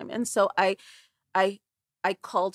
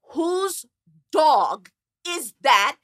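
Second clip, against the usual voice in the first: loud, drawn-out annoyed shout at a noise; mock angry shout; annoyed yell; 5 semitones higher, 8 dB louder, much slower than usual. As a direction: yell at whoever owns the dog, stretching it out. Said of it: "hearing this howling sound".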